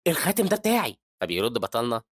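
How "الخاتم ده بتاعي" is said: high, louder startled claim; shocked. Acted. Man sees the boy holding his ring, shocked. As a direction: blurt it out in shock. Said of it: "فبيتصدم الراجل وبيقول".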